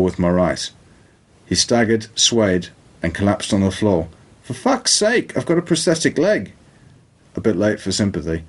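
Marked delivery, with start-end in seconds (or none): 4.4-6.5 high, strained voice of a hurt man; panicked high-pitched man. Acted. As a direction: shriek it out in a pained high voice. Elliot cries out after collapsing.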